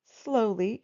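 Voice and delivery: ordinary delivery, nothing marked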